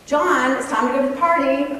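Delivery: put-on a touch of sing-out to a child; normal parenting voice. Parent calling son to leave for party, normal parenting voice. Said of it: "in your normal parenting voice".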